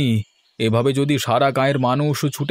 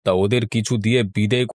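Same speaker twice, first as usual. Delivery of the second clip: lower elder's voice; 4 semitones lower than usual. Acted. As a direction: weigh the problem like an unhurried old landlord. Village elder Raymoshai speaks.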